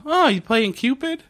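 high, teasing imitation of Gail; a chirpy, naive secretary, lightly played; mock Gail. Gail quoted teasing Prophet.

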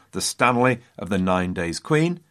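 ordinary talk

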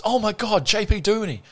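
high, excited fan gasp; starstruck fan. Retold or imitated. Fan spotting JP Duminy outside the nightclub, high and excited. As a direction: gush over the star like a thrilled fan. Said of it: "he goes".

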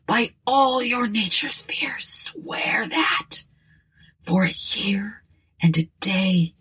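dying words. Acted. Dying man demands an oath.

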